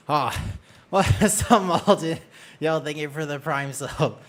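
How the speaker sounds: laughing through the thanks. Real, not acted. Streamer thanks Malden for the Prime sub, laughing. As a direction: chuckle out the greeting.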